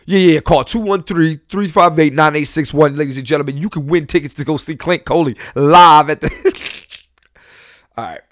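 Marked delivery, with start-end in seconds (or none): 0.0-6.3 loud, hyped radio DJ giving out the number; nineties radio host. Pretend. Comedian hamming up his show plug as a nineties radio DJ. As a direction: boom it like a giveaway on the air. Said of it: "I sound like a nineties radio host".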